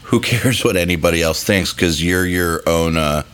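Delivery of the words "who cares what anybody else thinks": ordinary talk, nothing marked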